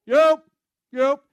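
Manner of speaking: high loud Yo, called out; a tourist booming the one word he knows; loud cheery yo. Retold or imitated. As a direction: greet everyone with a big Yo. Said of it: "So I go around Hungary going".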